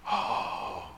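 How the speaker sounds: soft, voiceless "oh" of wonder; awed gasp. Acted. Preacher marvelling at Paul asking for Mark, awed gasp. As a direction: breathe out a hushed, long "oh". Marvels at Paul's changed view.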